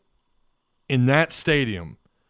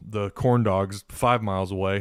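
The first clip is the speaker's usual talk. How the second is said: ordinary delivery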